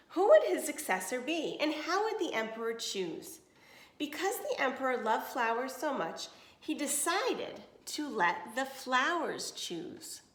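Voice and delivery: clear, even storybook reading; reading to children. Acted. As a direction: read it to a class of kids. Reads picture book to class.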